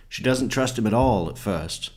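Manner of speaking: ordinary talk, nothing marked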